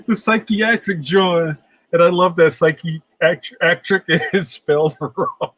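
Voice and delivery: talking through real giggles; laughing. Real, not acted. Host showing a fan's misspelt drawing to viewers, laughing. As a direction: let the laugh break up the words.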